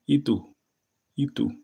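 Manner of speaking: high, loud "¿Y tú?"; Dominican casual. Shown as a demonstration. Returning the question to a friend, casual Dominican. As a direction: toss it back casually. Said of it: "we say".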